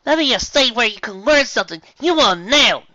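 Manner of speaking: high, loud stern voice; scolding Apple Bloom. Acted. Apple Bloom scolding Applejack, high loud voice. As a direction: lay down the law.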